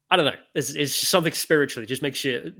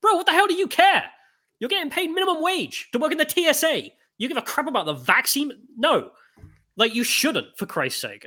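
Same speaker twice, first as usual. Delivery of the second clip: indignant rant; 11 semitones higher than usual. Real, not acted. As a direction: let the outrage spill out at him. Ranting at an imagined TSA agent.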